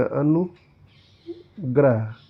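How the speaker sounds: अनुग्रह drawn out very slowly, each syllable spaced; slow teacher's enunciation of अनुग्रह; careful spelling demo. Shown as a demonstration. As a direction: sound out अनुग्रह slowly for students. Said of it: "अनुग्रह होता है".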